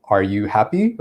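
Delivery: "happy" lifting upward at the end; rising question intonation. Shown as a demonstration. Teacher demonstrating a question, rising at "happy". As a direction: let the pitch climb on "happy". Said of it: "we would use this rising intonation at the end".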